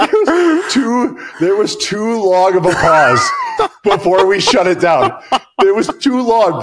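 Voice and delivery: words squeezed out high and loud through laughter; laughing while talking. Real, not acted. Laughing through his words about the long pause. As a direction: push the words through the giggles.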